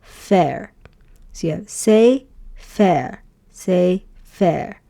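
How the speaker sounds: careful syllable chain say fair; teacher drilling the syllables. Shown as a demonstration. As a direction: repeat say fair steadily. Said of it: "you would pronounce it as".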